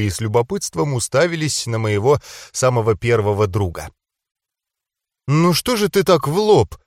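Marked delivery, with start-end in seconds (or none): 5.3-6.8 higher-pitched, mildly indignant protest; hesitant indignation. A narrator's reading. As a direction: protest a little unsurely. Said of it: "неуверенно возмутилась". (неуверенно, с лёгким возмущением)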